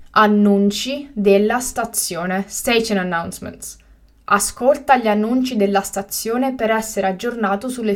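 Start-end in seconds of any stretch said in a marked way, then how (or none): 0.1-2.5 slow and carefully spaced; slow, clear repetition. Shown as a demonstration. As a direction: say it again slowly for learners. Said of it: "teacher repeats the word twice".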